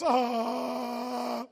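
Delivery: long, loud, held dumbstruck "uh"; hammed-up frozen stare as a sound; deer-in-headlights noise. Preacher on a big stage before her, mock frozen groan. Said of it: "the big deer in the headlights".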